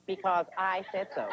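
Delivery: slow, drawn-out mom answer; mom on autopilot. Retold or imitated. Mom ending the why questions, slow pat answer. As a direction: stretch it as a final verdict. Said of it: "You notice the head goes".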